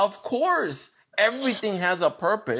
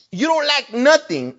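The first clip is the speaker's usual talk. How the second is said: ordinary delivery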